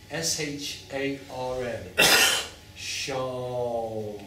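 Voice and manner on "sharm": "sharm" held long on a level tone; slow, lengthened "sharm"; drawn-out sound demo. Shown as a demonstration. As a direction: stretch "sharm" until the lips close on M. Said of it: "as long as you can do it".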